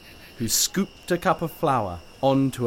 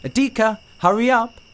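bright calling out, higher; cheerful call; 11 semitones higher, 5 dB louder than usual. A narrator's reading. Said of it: "she called cheerfully".

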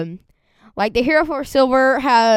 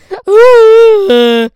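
high, loud cracking laugh; loud shrieking laugh; 11 semitones higher, 15 dB louder than usual. Real, not acted. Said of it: "I think that can hurt your throat".

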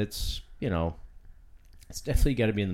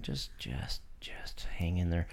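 whispering; 3 semitones lower, 9 dB quieter than usual. Real, not acted. Apologizing to listeners over feedback.

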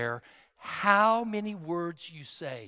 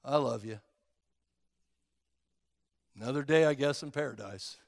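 bored and flat; 6 semitones lower, 6 dB quieter, faster than usual. Retold or imitated. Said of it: "He doesn't come over you and say".

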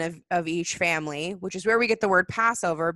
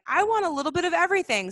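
put-on high, quick eager child voice; a greedy little kid wanting it all; excited kid; 10 semitones higher, 5 dB louder, faster than usual. As a kid asking for every filling, high and quick. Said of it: "I was like".

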